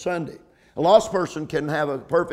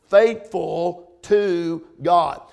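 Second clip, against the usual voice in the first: "faithful to God" very slow, each word set apart; slow emphasis; much slower than usual. Shown as a demonstration. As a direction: stretch out "faithful", then land "to" and "God" separately. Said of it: "I slowed down and said that with emphasis".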